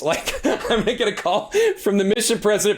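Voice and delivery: amused laugh as he tells it. Real, not acted. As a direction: chuckle through the words. Laughing at his own fear.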